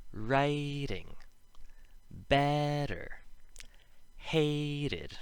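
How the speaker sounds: "writing, better, hated" with first vowels held high and long; exaggerated first-syllable stress on "writing", "better", "hated"; emphasized first vowel. Shown as a demonstration. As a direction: stretch the first syllable of "better", drop on the tapped second. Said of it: "This happens again in".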